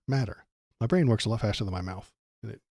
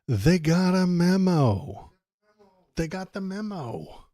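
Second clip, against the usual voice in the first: mocking; 6 semitones higher, slower than usual. Real, not acted. Mocking the memo excuse.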